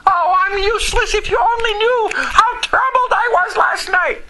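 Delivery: high-pitched hammed-up self-pity; mock wailing. Preacher mimicking a troubled believer after last night, mock wailing. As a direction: wail it like a mock despairing complainer.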